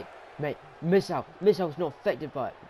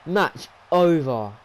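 emphatic call; 9 dB louder, much slower than usual. Real, not acted. Calling the match finished.

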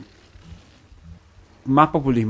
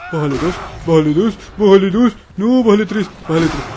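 frantic yelling; 5 semitones higher, 7 dB louder than usual. Real, not acted. Yelling at enemy to ease up.

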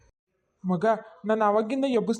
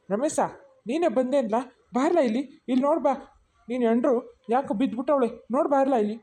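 ordinary delivery

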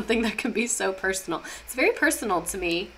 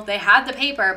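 ordinary talk, nothing marked

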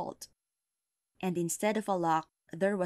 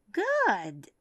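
witch voice; 6 semitones higher, 3 dB louder than usual. Acted. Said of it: "said the witch".

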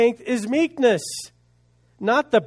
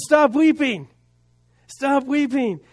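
high, raised-voice command to stop; emphatic command; 7 semitones higher, 4 dB louder, slower than usual. Acted. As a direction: voice an angel's urgent order, pitched high. Angel commands weeping John to stop.

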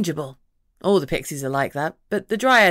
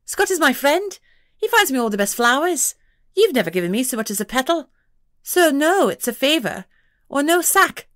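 high, louder pixie voice, insisting; stubborn pixie; 8 semitones higher, 4 dB louder than usual. A narrator's reading. Pixie to the detective, insisting on a favour. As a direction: dig in your heels, tiny and proud.

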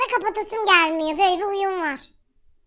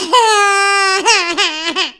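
loud gasp; 7 dB louder than usual. Real, not acted. Shocked gasp at filter.

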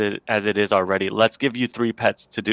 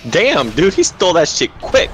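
louder, higher, startled gamer exclamation; real surprise, pitched up and loud; amazed outburst; 7 semitones higher, 6 dB louder than usual. Real, not acted. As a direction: blurt it out, impressed and caught off guard. Startled by opponent's quick steal in game.

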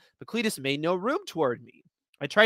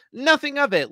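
teasing; 12 semitones higher, 5 dB louder than usual. Acted. Mocking reply, high pitch.

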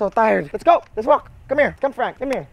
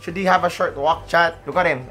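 ordinary talk, nothing marked

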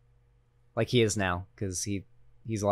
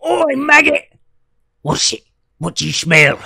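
much higher, louder Lord of the Rings orc snarl; orc impression; 7 semitones higher, 6 dB louder than usual. Joking that British impressions are just orcs, a loud orc snarl. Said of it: "Just, just do an impression of an orc".

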